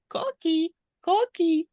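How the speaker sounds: high loud "Кокі, кокі" calls; coqui frog call. Shown as a demonstration. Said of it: "названа так через те, що вона робить саме такий звук".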